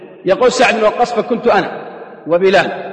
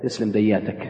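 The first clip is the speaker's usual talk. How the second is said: put-on a light Syrian accent, lower and softer; Syrian dialect; 9 semitones lower, 5 dB quieter than usual. Wife thanks husband in a Syrian accent. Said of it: "تسلم دياتك طبعًا بالسوري".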